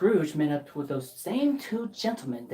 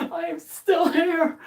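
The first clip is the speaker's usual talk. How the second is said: joyful shout; 12 semitones higher, 6 dB louder, slower than usual. Acted. Scrooge wakes joyful, shouting.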